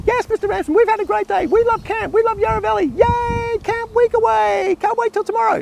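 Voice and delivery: put-on squeaky high chorus of excited boys; excited kids. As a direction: play a gushing ten-year-old. Boys answering the camp teacher.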